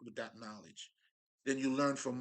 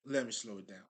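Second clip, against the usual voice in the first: ordinary delivery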